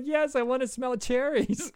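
hammed-up eager child; eager little kid. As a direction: sound like a thrilled five-year-old. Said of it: "And I was like".

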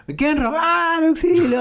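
shrill high imitation of a drunk girl crying for help; hammed-up cry of "auxilio"; mock scream. (grito fingido y agudo de auxilio)

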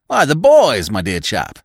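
loud, high, hearty exclamations; exuberant, drawn-out old-chum voice; jovial gushing. A narrator's reading. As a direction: gush fondly over old pals. Jovial stranger gushes over old times.

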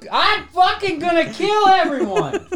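hammed-up outrage; mock rage. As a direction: explode in fake fury over candy. Mock rage over candy debate.

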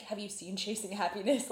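ordinary talk, nothing marked

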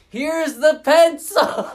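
loud, high, drawn-out call of here's the pencil; excited yell. Retold or imitated. Chasing his friend across the field, a loud high slow shout. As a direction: shout it after your friend, thrilled. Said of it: "And I'm like".

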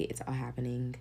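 usual speaking voice

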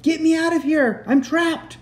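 high, urgent acted shout; high-pitched storybook cry for rescue; crying for help. A narrator's reading. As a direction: plead loudly as if trapped. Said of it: "he cried in a gruff voice".

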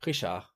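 Richard with a throaty French R; French throat R opening Richard; French pronunciation. Shown as a demonstration. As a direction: say Richard as ree-SHAR, French style. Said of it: "you wouldn't say that".

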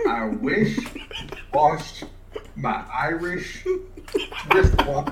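laughing through the twister. Real, not acted. Struggling through the twister for the cohost, laughing. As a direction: stumble word by word, giggling. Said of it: "It's so hard".

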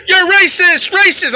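high, loud mock scream of an accuser; imitated screaming. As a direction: yell it out like a screaming protester. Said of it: "lady screaming".

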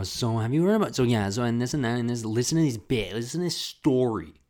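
mock the excited son bursting with stories; hammed-up chatty son talking at his mom; eager chatter. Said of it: "having me go".

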